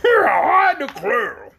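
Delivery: loud, high, long-drawn exclamation; exclaiming donkey king. A narrator's reading. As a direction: draw it right out like an astonished donkey king. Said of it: "exclaimed the King".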